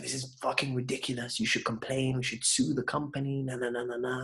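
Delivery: ordinary talk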